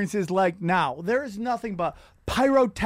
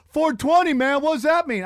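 higher, louder curious fan voice; puzzled fan; 6 semitones higher, 4 dB louder than usual. Retold or imitated. Fans asking about his jersey, higher puzzled voice. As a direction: play a baffled fan at the game.

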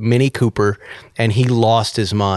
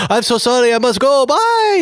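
put-on much higher, laughing German-accented farewell; hurried German goodbye; 12 semitones higher than usual. German man leaving for meeting, high laughing goodbye. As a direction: rush off apologising, voice climbing. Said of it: "And he goes".

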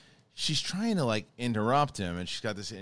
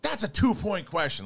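higher, louder quiz-host voice; bright mock announcement; 9 semitones higher, 4 dB louder than usual. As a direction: announce it like a game-show host. Quoting Andreyko.

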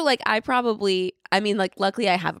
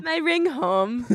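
broken English; 7 semitones higher, much slower than usual. Pretend. Joins broken English bit.

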